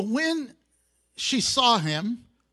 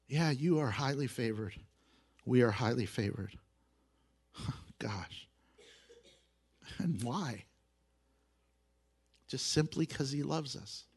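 soft and low, with long pauses; hushed, moved sincerity; 8 semitones lower, 8 dB quieter than usual. Real, not acted. Preacher telling the congregation God loves them undeservedly, hushed and humbled. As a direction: drop right down into humbled wonder.

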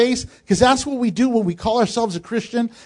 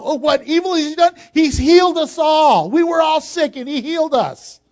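yelling out; 7 semitones higher than usual. Pretend. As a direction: shout it like grateful healed people defending him. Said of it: "These people should be yelling out".

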